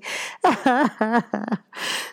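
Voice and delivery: high hearty laughter. Real, not acted. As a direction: burst out laughing. Big laugh at the slang.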